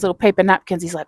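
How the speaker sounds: usual speaking voice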